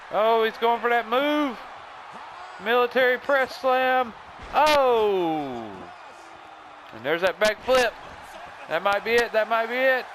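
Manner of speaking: high, breathless-with-excitement wrestling commentary; excited play-by-play. Pretend. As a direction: call the big slam and near fall at full excitement. Live play-by-play of the match.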